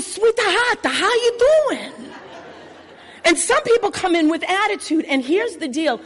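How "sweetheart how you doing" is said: put-on high, sing-song Italian-American accent; a cheery Italian pizza man with a laugh; Italian sweetheart greeting. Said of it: "everybody's a".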